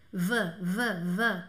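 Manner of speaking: stretched "V, V, V" buzzing; V sound. Shown as a demonstration. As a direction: buzz out the V. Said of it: "ele fica com som de V".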